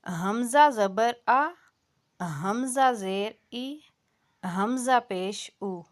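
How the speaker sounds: ہمزہ زبر آ، ہمزہ زیر ای، ہمزہ پیش او said softly with no jolt; soft correct hamza. Shown as a demonstration. Tajweed teacher models softly ended vowels for students. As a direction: release each vowel gently. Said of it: "یعنی نرمی سے اس کو آپ نے ختم کر دیا".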